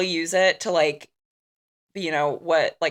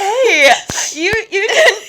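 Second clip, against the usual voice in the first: high, loud, breaking up laughing; stuttering through real laughter; helpless laughter; 9 semitones higher, 3 dB louder, slower than usual. Real, not acted. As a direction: try to quote it while cracking up. Cannot get quote out for laughing.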